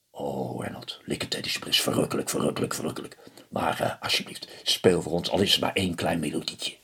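put-on low, hushed little old man begging; pleading little old man. A narrator's reading. Little old man begs fiddler Reynold to play, low and hushed. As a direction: coax him softly like a tiny old man. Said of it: "old man pleads with Reynold".

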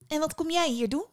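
put-on high, lilting girl's question; inquisitive girl. A narrator's reading. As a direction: ask it as a curious girl.

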